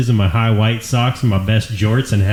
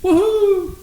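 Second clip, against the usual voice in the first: put-on very high child's whoop; imitated daughter's cheer. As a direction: mimic an excited kid's squeal of woohoo. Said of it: "turns to her mom and goes".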